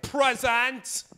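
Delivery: high, posh-sounding British "Present"; British accent. Retold or imitated. Kid answers roll call in a British accent. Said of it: "speaking a British accent".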